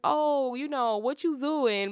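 high, mock extra voice with a Z in doing. Mimicking her teenage self to a friend, hammed up. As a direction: ham up the Z sound on doing. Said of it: "I was like".

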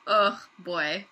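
ordinary talk

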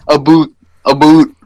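mock Canadian "about" as "A boot". What Americans hope Canadians will say, mock Canadian accent. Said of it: "they're hoping you say".

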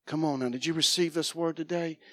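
ordinary delivery

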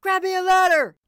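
high, very loud yell; yelling guard. A narrator's reading. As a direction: yell an order across the square. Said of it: "yelled another".

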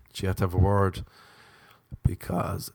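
ordinary talk

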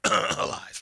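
word hidden inside a fake cough. Host undercutting his own claim, word disguised as a cough. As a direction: cough it out as a pointed aside.

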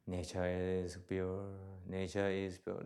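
low, soft chant of a mantra; repeated mantra. Shown as a demonstration. Teacher demonstrating a mantra to the audience, low soft chant. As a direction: murmur the phrase like a meditation mantra. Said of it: "Then you can repeat".

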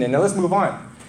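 usual speaking voice

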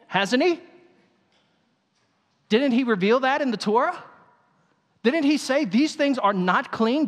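high, loud, pleading disbelief; incredulous high protest. Acted. Peter arguing with heaven.

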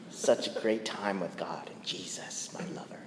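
breathy whisper; mock whisper. Preacher mocking a doting believer, whispered. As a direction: whisper it dreamily.

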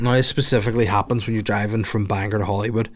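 normal talking voice

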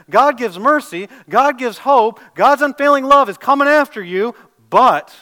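loud, rising proclamation of mercy; preacher at the peak of the sermon; full-force preaching. Acted. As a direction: build it up and ring it out. Preaching climax on scripture.

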